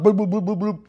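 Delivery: rapid-fire run of bloops; too-fast boiling. Shown as a demonstration. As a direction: imitate a hard boil, bloops tumbling together. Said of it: "that's no good".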